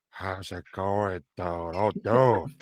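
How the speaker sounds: mock deadened Frank impression, drawn out; dead inside. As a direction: imitate fans doing Frank slowly, utterly lifeless. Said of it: "they sound so dead inside".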